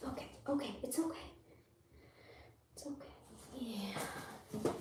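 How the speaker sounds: soft, hushed reassurance; calm the scared bunny gently; soothing. Real, not acted. Soothing frightened rabbit.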